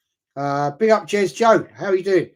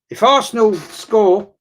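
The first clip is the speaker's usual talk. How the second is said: higher and louder with rising hope; excited; 6 semitones higher, 7 dB louder than usual. Real, not acted. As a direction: lift the voice as the chance builds. Tense penalty moment, stream reaction.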